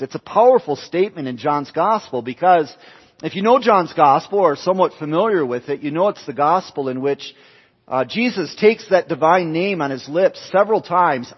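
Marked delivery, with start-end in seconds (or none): none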